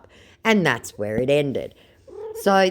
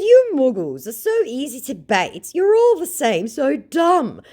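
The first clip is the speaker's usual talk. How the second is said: taunting jeer; 11 semitones higher, 5 dB louder than usual. Retold or imitated. Taunting scammer.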